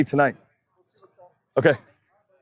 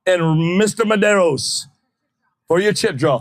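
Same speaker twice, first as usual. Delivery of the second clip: raised announcer voice, a little higher; track announcer call; 6 semitones higher than usual. Acted. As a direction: call the next pair like a drag strip announcer. Calling next pair on the PA.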